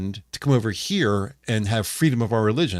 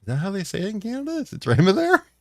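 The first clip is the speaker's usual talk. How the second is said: mock surprised question; 9 semitones higher than usual. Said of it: "I thought".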